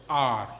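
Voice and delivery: R as a long open "aah"; R held open in the throat; English R from the throat. Shown as a demonstration. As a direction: say R as "aar", from the throat. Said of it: "au niveau du gorge".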